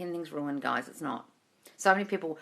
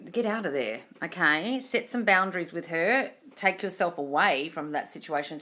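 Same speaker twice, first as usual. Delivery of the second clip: ordinary delivery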